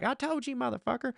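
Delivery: high, soft imitated wife; mock wife's scolding voice. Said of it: "my wife is in the other room, just like".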